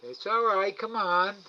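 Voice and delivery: high, loud sing-song coaxing of an animal; coaxing pet talk. Real, not acted. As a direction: coax the shy raccoon to come and eat. Coaxing the raccoon.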